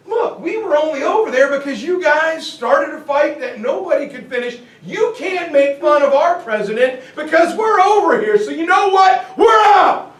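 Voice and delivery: indignant outburst building to a shout. Retold or imitated. Americans fed up with Europe.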